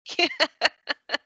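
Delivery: high, bubbling run of laughter; delighted laugh. Real, not acted. Teacher sees a student get it, delighted high laugh. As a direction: laugh out, pitched well up.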